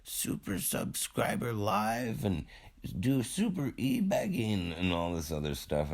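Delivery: high, breathless fangirl gush; gushing friend voice. Retold or imitated. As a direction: gush like an overexcited YouTuber friend. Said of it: "they're like".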